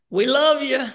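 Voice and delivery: loud, high happy reply; family's voice. Retold or imitated. Dad answering warmly on the phone, loud and high. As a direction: beam it back at him. Family says love you.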